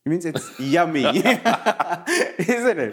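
giggling through words; laughing. Real, not acted. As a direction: laugh while speaking. Laughing after yummy demo.

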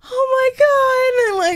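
high, slow, wailing sob of "oh my God"; hammed-up crying retelling a panic; mock crying. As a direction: act out her teenage self bursting into tears. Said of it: "And I started crying".

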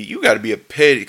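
plain reading voice

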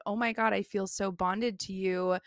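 ordinary talk, nothing marked

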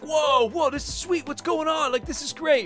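high, excited awestruck gasps; amazed crowd. Retold or imitated. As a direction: gush like a wowed clubgoer. Crowd amazed at ninja fight.

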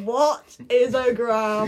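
slow, loud, baffled "What is a gram?"; baffled medieval woman. Pretend. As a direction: drag it out, totally lost.

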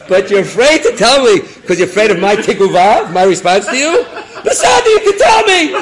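loud, high challenge building to a shout; emphatic shouting. Acted. Rabbi daring the officer to speak up, emphatic shouting.

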